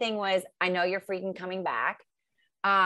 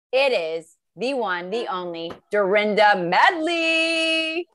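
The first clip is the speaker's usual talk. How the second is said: loud, slow announcer build-up; announcer intro; 6 dB louder, slower than usual. Pretend. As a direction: introduce her like a star. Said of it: "read you my poem".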